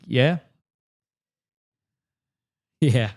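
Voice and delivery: ordinary talk, nothing marked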